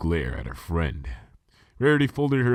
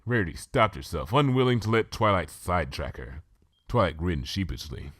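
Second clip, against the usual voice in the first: plain reading voice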